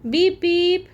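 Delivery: high, loud horn beep; playful beep beep; car horn beep. Acted. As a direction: honk like a toy car.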